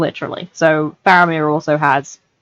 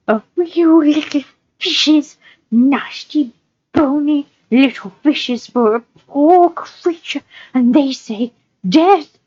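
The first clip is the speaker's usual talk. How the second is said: high, slow, drawn-out creature voice; Gollum, self-pitying; 6 semitones higher, much slower than usual. Acted. Captive creature answering his judge, self-pitying Gollum voice. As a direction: stretch the words like a hungry, wretched creature.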